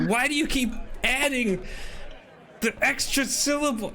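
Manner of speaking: high, exasperated voice; high, fed-up friend scolding. Acted. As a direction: scold him after a smack. Thistle scolding Anders.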